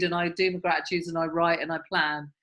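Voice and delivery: usual speaking voice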